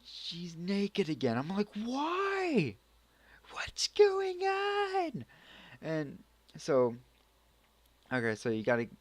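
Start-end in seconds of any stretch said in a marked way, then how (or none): 1.7-5.2 very high, drawn-out squeal; mock distress. Vlogger reacting to nudity again, hammed-up falsetto wail. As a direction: squeak it out in exaggerated despair.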